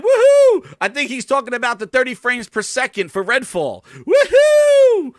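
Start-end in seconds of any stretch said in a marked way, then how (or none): 0.0-0.7 high, loud whooping cheer; excited cheer. Real, not acted. Cheering Phil's news tease with a loud whoop. 4.0-5.1 high, loud whooping cheer; excited cheer. Real, not acted. Cheering Phil's news tease with a loud whoop.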